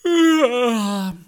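high, loud drawn-out yes; bored teen yes. A narrator's reading. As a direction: groan out a bored teenage yes. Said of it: "machte die fünfzehnjährige". (gelangweiltes lautes Ja der Fünfzehnjährigen)

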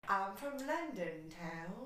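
slow put-on London accent; drawn-out mock British. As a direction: play a Londoner, unhurried. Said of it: "make a little bit of a British accent".

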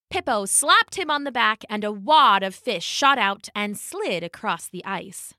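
ordinary delivery

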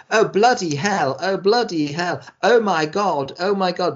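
hammed-up fake English accent, mock frightened; overdone English accent of a scared character; mock bad English accent. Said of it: "the, the awful accent".

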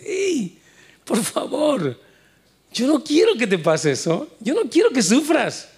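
high, pleading voice of God as a loving father. Acted. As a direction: beg the listener like a father who fears for his child.

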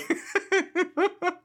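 high giggling burst; burst of laughter. Real, not acted. Laughing at own joke.

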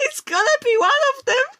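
high laughing voice. Real, not acted. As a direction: speak through laughter. Laughing through the sentence.

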